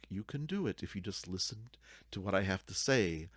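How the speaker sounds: ordinary talk, nothing marked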